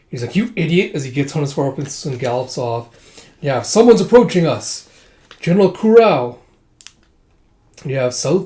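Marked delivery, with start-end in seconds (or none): 0.4-0.9 mild mock exasperation. Retold angry insult at departing rider. 3.4-6.4 raised, louder warning cry; urgent heads-up to the troops; alarmed call. Retold or imitated. Retold lookout announces approaching enemy general.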